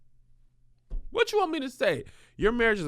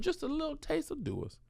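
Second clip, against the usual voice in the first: usual speaking voice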